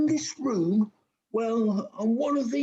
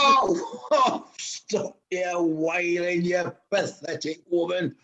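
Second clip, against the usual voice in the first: low sneering panto villain; scornful baddie, deep and mocking; 6 semitones lower than usual.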